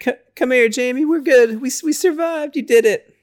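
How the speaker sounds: high, relieved comforting voice; lightly voiced relieved girl consoling her friend; mildly voiced relief, pitched high. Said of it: "she's like".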